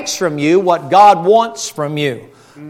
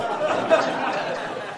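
breathy laugh; 8 semitones higher, 9 dB quieter than usual. Real, not acted. Laughs after own joke.

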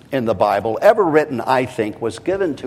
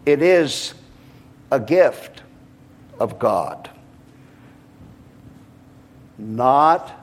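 measured phrases with long pauses, settling down; slow, deliberate reading. Real, not acted. Preacher reading that salvation is a gift, slow and deliberate. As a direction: break it into small, weighty pieces. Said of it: "very deliberately".

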